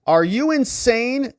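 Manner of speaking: incredulous scold. Retold or imitated. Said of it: "she scolds Hunter".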